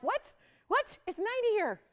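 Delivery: high squeaky mock-surprised what; shocked disbelief. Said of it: "And I'm like".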